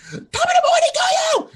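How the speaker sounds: very high, loud screeching greeting; screeching YouTuber. Retold or imitated. Mocking Jacksepticeye's intro, high screeching shout. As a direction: screech like a hyper streamer. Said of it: "he's, like, almost screeching".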